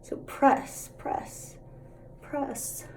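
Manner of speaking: whispered, rhythmic press; soft whisper. Shown as a demonstration. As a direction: murmur each press softly. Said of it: "We're gonna wipe away the bake".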